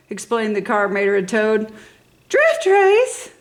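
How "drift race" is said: high excited squeal; girly car squeal; squealing girl cars. A narrator's reading. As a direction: squeal it with delight. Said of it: "girl cars squealed".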